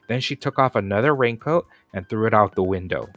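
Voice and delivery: usual speaking voice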